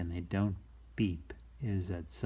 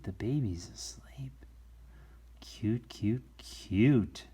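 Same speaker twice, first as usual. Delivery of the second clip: whisper; slower than usual. Acted. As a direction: whisper it tenderly. Whispering so baby sleeps.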